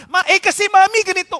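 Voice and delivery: put-on high, fast child making excuses; child answering back. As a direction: play a kid hurriedly explaining to mom. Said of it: "Sabi ng anak".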